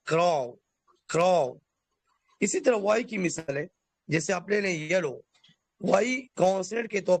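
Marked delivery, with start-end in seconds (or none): 0.0-1.5 کراؤ with a flowing, stretched final glide; drawn-out crow. Shown as a demonstration. Teacher models کراؤ for students, stretched ending. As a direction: let کراؤ glide long and smooth. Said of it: "روانگی اور کھچاؤ ہے".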